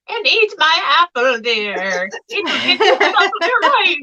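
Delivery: high, hammed-up old witch voice; cackling fairy-tale hag offering an apple.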